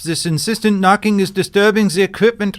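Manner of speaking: put-on loud, stern German accent through the door; a German scientist scolding in a heavy accent. German scientist shouting at Rose through the door, German accent. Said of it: "the German scientists refuse to let her in".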